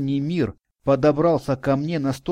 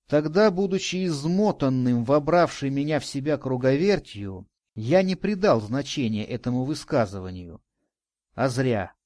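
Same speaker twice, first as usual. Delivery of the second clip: usual speaking voice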